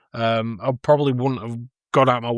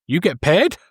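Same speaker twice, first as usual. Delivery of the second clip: surprised Zangief; 4 semitones higher than usual. Retold or imitated. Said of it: "Zangief's just stood there like".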